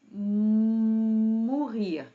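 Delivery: "mourir" with a long, loud hummed M; stretched, emphatic teacher's "mourir"; drawn-out sounding-out. Shown as a demonstration.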